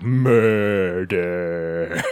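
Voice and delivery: Murder stretched long and low; drawn-out murder. Retold or imitated. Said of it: "every time they say murder, he's like".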